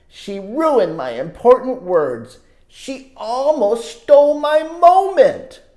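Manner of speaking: put-on lively read-aloud for kids; storybook narration. A narrator's reading. Reading a story to children, animated narration. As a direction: tell it like a picture book to children. Reads picture book aloud.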